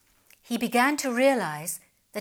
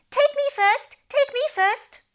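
high, eager shouting fish; crying out; 5 dB louder than usual. Acted. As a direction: clamour to go first. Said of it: "began to cry out".

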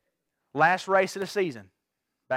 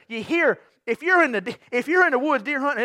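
usual speaking voice

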